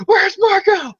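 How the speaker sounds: high, strained, screaming and half-crying; hammed-up hysterical high shouting; mock frantic yelling. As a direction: shriek like a raving lunatic. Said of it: "This is basically what Peter's doing".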